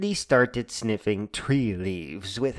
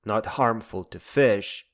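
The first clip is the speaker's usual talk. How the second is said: ordinary talk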